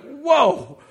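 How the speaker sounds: high, loud startled whoa; jolted by the surprise; stunned exclamation; surprised whoa. Retold or imitated.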